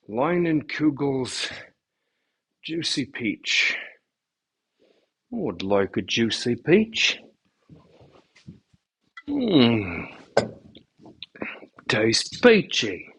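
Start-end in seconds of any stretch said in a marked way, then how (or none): 2.6-13.1 slow, whispered mock British accent. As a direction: try a hushed British accent on it. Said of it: "I don't know if that's a British accent".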